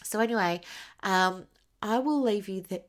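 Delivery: hiccup cuts the word; broken by a hiccup. Real, not acted. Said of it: "Sorry, I've got the hiccups".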